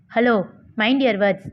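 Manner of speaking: usual speaking voice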